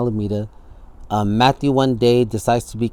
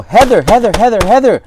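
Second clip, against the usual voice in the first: high, loud repeated calling; shouting for help to his girlfriend; panicked shout; 10 semitones higher, 9 dB louder than usual. Retold or imitated. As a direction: yell for her in alarm.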